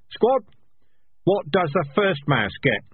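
loud drill-sergeant call to the squad. A narrator's reading. Sergeant calling out to his squad, loudly. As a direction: bark the question at the whole squad. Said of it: "He raised his voice".

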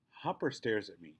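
plain reading voice

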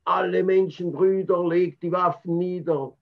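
put-on declaiming in Swiss German accent. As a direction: orate like a Swiss street preacher. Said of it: "in his Swiss accent".

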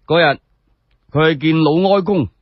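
ordinary delivery, nothing marked

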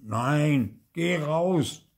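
put-on curt, unfriendly child's order. A narrator's reading. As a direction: send them out rudely. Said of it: "sagt Theo unfreundlich".

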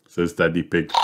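usual speaking voice

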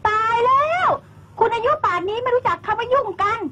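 high, incredulous exclaim; exasperated shock. Acted. Woman shocked that the wife does not understand. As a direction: scoff at her innocence.

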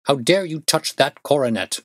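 a father catching his son red-handed; furious accusing cry. A narrator's reading. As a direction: hurl the accusation at him. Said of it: "I screamed".

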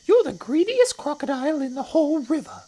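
high, loud accusing little croc; not-so-big crocodile. Acted. Not-so-big crocodile accusing the enormous one, high scolding voice. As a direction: scold in a tiny high creature voice.

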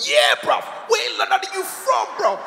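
imitated gang challenge, rising high; high-pitched mock youth challenge; youths joining in. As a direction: chime in as another cocky youth. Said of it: "They all joined".

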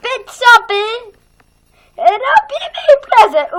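high, loud excited shout; host calling everyone to the party. Acted. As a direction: yell it out to kick off the party. Character announces a party.

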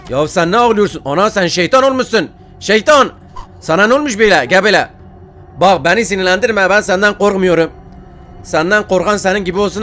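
real flustered yelling; scolding shout. Real, not acted. Yelling at the devil baby.

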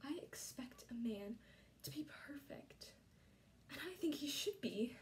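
soft whisper of her ideals; half-whispered admission to George; whispered confession. Acted.

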